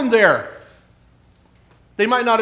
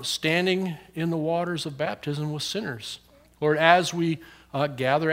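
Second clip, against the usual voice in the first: prayer; 4 semitones lower than usual. Acted. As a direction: lead the children gently in prayer. Pastor prays with the children.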